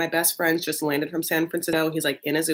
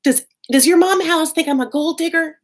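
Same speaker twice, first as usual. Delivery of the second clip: put-on tense girlfriend voice; 11 semitones higher, 6 dB louder than usual. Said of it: "tense call from Tracy where she's like".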